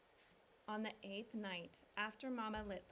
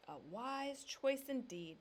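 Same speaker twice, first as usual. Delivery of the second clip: slow, smiling, a lightly voiced papa; smiling approval; 4 semitones higher, 3 dB louder, slower than usual. A narrator's reading. Papa approving his son's dog name, smiling and slow. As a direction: approve it with a smile. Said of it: "said Papa, smiling".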